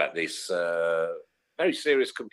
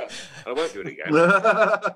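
laughing; 8 semitones higher, 4 dB louder, much slower than usual. Pretend. Laughing repeat of the apology.

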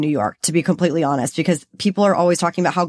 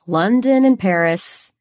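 usual speaking voice

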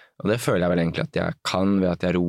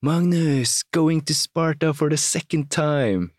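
imitating an eager Turkish runner calling out; mock shout; 9 semitones higher, slower than usual. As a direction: hail him cheerfully as you run up. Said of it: "og roper sånn".

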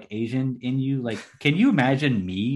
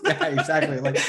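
laughing; 6 semitones higher than usual. Real, not acted. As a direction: giggle through the words. Joking about forty holy songs.

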